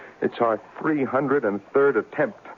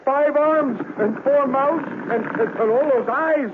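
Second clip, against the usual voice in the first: much higher, frantic panic at the monster; panicked alarm; 13 semitones higher than usual. Acted. Scientist sees a monster rise from his test tube, panicked and much higher. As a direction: shriek out the horror as it crawls out.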